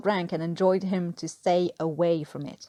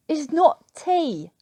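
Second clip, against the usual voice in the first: higher and louder, exasperated lecture; indignant rant; 9 semitones higher, 6 dB louder, much slower than usual. Acted. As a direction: lecture him in outraged disbelief. Newkirk's verdict on the tea.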